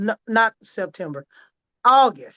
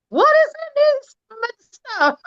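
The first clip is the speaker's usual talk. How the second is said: much higher, slow, crying exclamation; tearful amazement; 14 semitones higher, 4 dB louder, slower than usual. Retold or imitated. Retelling her shock at the swelling going down, tearful cry. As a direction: cry it out in disbelief. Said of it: "I call Rosa in tears".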